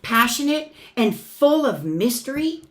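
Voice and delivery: loud, sweeping proclamation; booming storybook wind line; big wind voice. Acted. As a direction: blow it out like a gust of wind. Wind passage, much louder.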